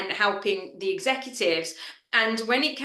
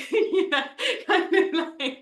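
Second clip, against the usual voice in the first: laughing through the words, higher; 8 semitones higher, slower than usual. Real, not acted. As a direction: giggle it out. Laughs after her story.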